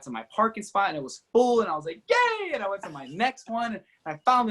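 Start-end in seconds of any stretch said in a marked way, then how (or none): 2.1-2.5 delighted yay. Retold or imitated. Delighted at the full parking spot.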